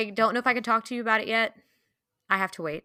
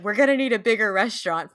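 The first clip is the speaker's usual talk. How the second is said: imitating a yell, louder and a bit raised; mock yell; 3 semitones higher, 5 dB louder, faster than usual. Host quoting Teddy's Jaws parody line, mock yell. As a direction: give a half-hearted movie-quote holler. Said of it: "Teddy just yelling".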